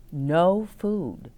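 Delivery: ordinary delivery, nothing marked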